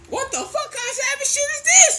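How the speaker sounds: very high, loud, comic outcry; hammed-up shocked yell; retold horrified shout. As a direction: shriek it in mock horror. Kid horrified by mother eating baby.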